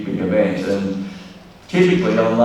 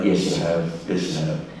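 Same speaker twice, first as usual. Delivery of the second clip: calm reply; 5 semitones lower, 7 dB quieter than usual. Retold or imitated. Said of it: "Goldman said".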